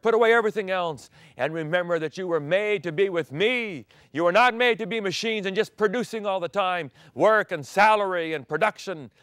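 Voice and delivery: loud, high fervent sermon; full-force preaching. Acted. Pastor preaching God's Sabbath to the congregation. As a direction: preach it with fire.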